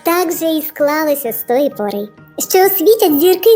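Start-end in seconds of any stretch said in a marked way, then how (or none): none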